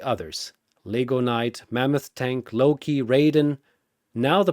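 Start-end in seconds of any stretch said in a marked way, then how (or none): none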